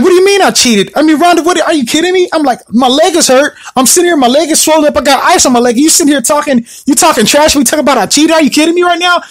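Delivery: high, indignant disbelief at the accusation; exasperated protest. Retold or imitated. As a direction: retell it like you still can't believe her.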